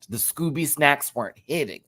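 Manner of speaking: ordinary talk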